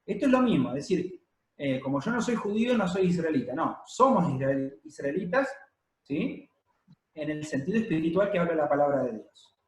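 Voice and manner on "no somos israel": usual speaking voice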